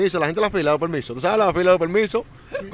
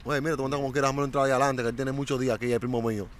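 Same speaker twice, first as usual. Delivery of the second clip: confiding murmur; 4 semitones lower, 6 dB quieter than usual. Retold or imitated. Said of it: "quoting his pitch to people in line".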